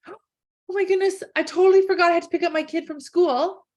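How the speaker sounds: mock panic, racing through the words; sudden panic. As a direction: blurt it out as if you just remembered. Acting a sudden panicked thought.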